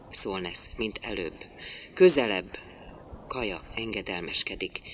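plain reading voice

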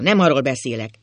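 loud, emphatic correction; heated. A narrator's reading. Old husband correcting his wife, heated. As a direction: hit the first word hard. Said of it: "felelt az öreg indulatosan".

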